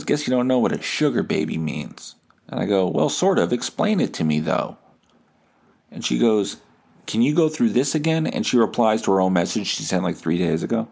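ordinary talk

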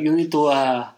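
imitated hesitant Italian accent; halting Italian-accented English, groping for words. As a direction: mimic an Italian urging patience. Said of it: "for a while before he says it".

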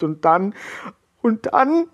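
rising, high, voice cracking into a sob; breaking into sobs. A narrator's reading. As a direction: choke on the words before sobbing. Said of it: "verfiel Arno wieder in lautes Schluchzen". (kurz vor dem Schluchzen)

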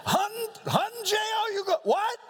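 a laughing, high mock shout; mock excited yell. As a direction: squeal it like an overexcited hunter. Mock excited hunting buddy.

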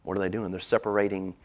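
usual speaking voice

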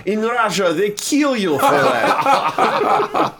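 put-on high, drawn-out Russian accent. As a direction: imitate a tipsy Russian, stretched and high. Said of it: "he's no accent all night, but it came out".

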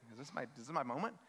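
quick, low, soft aside; retold private thought, hurried and hopeful; under-the-breath hopeful wondering; hushed hope.

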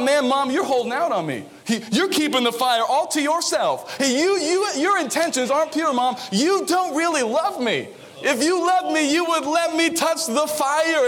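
put-on high pleading kid accusing mom, laughing; a child sulking that mom's hogging the fire; a hammed-up kid's complaint to mom; whining child. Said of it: "I thought".

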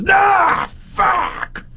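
high yelled curse; mock despair. Friend despairing in the car, high yelled curse. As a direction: throw it out in anguish. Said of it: "He goes".

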